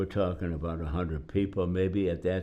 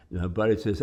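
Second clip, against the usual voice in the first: normal talking voice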